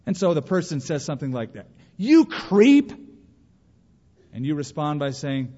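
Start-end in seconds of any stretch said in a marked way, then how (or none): 2.0-2.9 high, loud scornful jab; angry insult. Acted. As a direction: spit the insult out sharply. Acted insult from an enemy.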